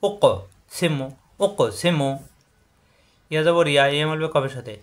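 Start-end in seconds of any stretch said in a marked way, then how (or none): 0.1-2.1 ও ক bounced, সিমু without a bounce; qalqalah bounce. Shown as a demonstration. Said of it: "এখানে বাড়ি খাবে".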